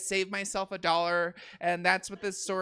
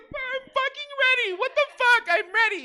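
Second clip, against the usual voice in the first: high, loud mock yell; exasperated shout; 11 dB louder than usual. Comic yelling at stranger who won't start the joke. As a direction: blast it out, fed up.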